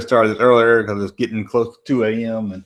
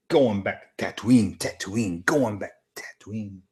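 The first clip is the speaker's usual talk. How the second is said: muted echo; 2 semitones lower, 6 dB quieter than usual. Acted. Streamer repeating her Tatooine line to himself, subdued. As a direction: let the words die away as an echo. Echoing the line softly afterwards.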